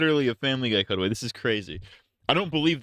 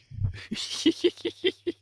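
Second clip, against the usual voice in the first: high laugh. Real, not acted. Reacting to absurd story.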